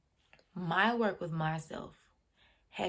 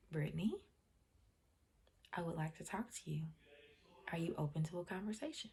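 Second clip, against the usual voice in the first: calm, gentle invitation; 8 dB quieter, faster than usual. Retold or imitated. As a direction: quietly invite an upset child to talk. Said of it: "I spoke to her calmly".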